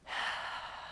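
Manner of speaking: long, soft breathy sigh; deep audible breath. Shown as a demonstration. Yoga teacher modelling a breath, deep audible sigh. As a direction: breathe audibly to lead the class.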